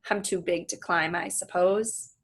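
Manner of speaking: ordinary delivery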